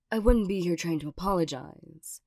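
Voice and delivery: ordinary talk, nothing marked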